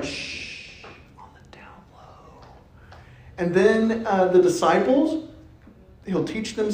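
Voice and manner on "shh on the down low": hushed, breathy secret-keeping whisper; mock whisper. Jesus hushing a healed man, playful whisper. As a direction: shush them like a conspirator. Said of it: "and then he's like".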